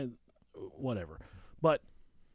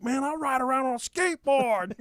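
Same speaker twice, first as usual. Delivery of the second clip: loud, very raised comic retort; imitated exasperated yell; 10 semitones higher, 5 dB louder than usual. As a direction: shout back as the fed-up victim. Acted street quarrel, victim retorts.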